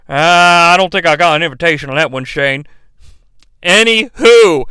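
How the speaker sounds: usual speaking voice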